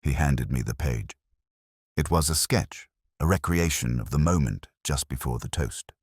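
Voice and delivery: ordinary delivery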